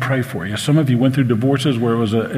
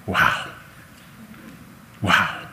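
awed wow; 6 semitones lower, 7 dB quieter than usual. Retold or imitated. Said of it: "all of them utter the same word".

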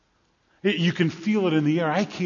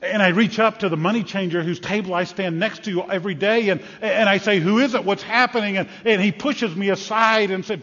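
loud, higher, breathless rush of events; frantic account; 3 semitones higher, 4 dB louder than usual. Acted. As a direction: tell it caught up in the chaos. Blind man describes temple chaos.

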